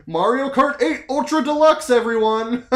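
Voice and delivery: loud, high mocking announcer; hammed-up announcer shouting to everyone; mock announcement. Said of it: "mock announcement".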